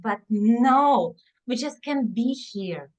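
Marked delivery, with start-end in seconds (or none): none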